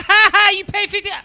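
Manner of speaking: high, loud jeering with mock laughter; taunting clerk. Check-in woman pointing at him, loud high jeering. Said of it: "she's, like, pointing at me".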